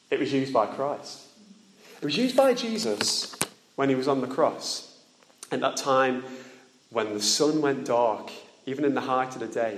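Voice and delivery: ordinary delivery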